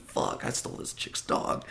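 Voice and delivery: breathless muttered aside; a guilty inner whisper. Retold or imitated. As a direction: hiss it under your breath.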